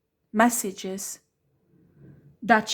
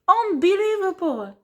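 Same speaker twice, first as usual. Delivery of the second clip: outraged disbelief; 8 semitones higher, 4 dB louder than usual. Real, not acted. Outraged exclamation mid-rant.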